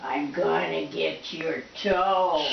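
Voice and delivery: low slow teasing growl; playful monster growl. Pretend. Playing with pet bird.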